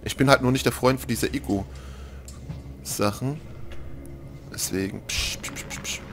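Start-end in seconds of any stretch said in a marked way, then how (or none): 5.1-6.0 softer hushed shushing puffs; breathy psch sounds while sneaking; whispered psch. Real, not acted. Player sneaking past, whispered psch noises.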